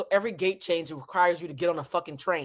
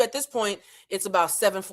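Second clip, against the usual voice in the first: normal talking voice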